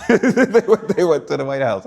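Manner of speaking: laughing through the words; bursting out laughing mid-phrase; high, loud laugh breaking up the words. Real, not acted.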